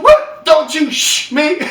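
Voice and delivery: high, loud, drawn-out indignant retort; retold indignant yelp; mock outrage. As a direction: act out an offended woman, breaking into laughter. Retold woman outraged at being shushed.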